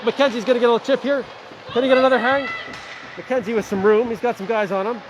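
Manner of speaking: quick, lively game call; live play-by-play. Acted. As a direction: follow the puck as you call it.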